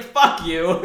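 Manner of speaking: loud retold outburst; indignant reenacted yell; going off. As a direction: hurl it at her in mock outrage. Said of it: "went off on her".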